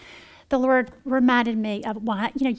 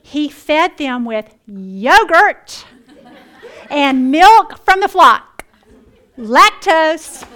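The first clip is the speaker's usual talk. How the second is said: loud, slow, higher and laughing through yogurt and milk; laughing disbelief; 4 semitones higher, 12 dB louder, much slower than usual. Acted. Reading the verse to the women, loud laughing disbelief. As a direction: read it like the joke is on you.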